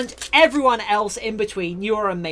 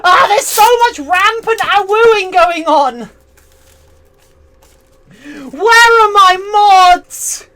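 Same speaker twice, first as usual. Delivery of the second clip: mock despair; 7 semitones higher, 8 dB louder than usual. Mock despair over awooing.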